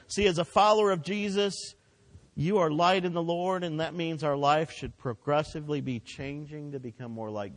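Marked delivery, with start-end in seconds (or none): none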